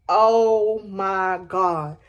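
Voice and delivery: slow, low, drawn-out dread. Retold or imitated. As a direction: let it sink out slowly, heavy with dread. Dread seeing police trucks pull up.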